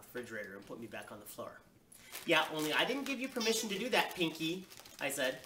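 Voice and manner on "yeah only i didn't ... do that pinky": put-on higher-pitched, indignant little kid; bossy protest. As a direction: object like a child playing boss. Child protests being lifted down.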